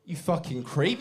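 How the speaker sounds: ordinary talk, nothing marked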